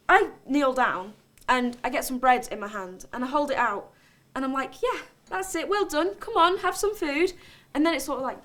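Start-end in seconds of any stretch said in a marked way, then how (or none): 4.8-7.3 softer, higher, gentle coaxing of an animal. Acted. Storyteller luring a swan with bread, softly coaxing. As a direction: lure it in with a soft, lifted voice.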